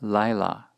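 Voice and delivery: lila with the accent on its first syllable, LI-la; "lila" leaning on the first syllable; first-syllable stress. Shown as a demonstration. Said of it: "does have the accent on the first syllable".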